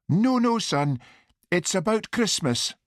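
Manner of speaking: high-pitched mother's voice; mother on the phone. A narrator's reading. Mother telling son it's about Christmas, high voice. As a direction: voice a mother answering her son.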